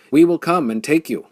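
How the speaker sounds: a bellowed threat across the rocks; loud threat. A narrator's reading. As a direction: shout the threat at them. Enemy leader threatens.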